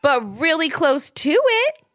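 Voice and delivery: put-on high, drawn-out, hedging child; smiling evasion. A narrator's reading. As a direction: stretch it out coyly with a smile. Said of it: "smiled Ivy".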